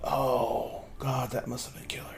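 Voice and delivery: very soft, hushed envy; barely above a whisper. Acted. Weird guy hearing about the game update, hushed. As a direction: breathe it out quietly. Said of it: "in this voice that's barely above a whisper".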